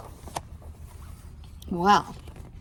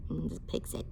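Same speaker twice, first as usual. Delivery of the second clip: ordinary delivery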